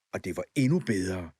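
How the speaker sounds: ordinary delivery, nothing marked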